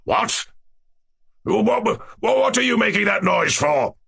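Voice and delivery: high-pitched, spluttering royal demand; furious. A narrator's reading. King accusing dwarf of the noise, furious. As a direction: stammer out the question in outrage. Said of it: "turning furiously to the dwarf".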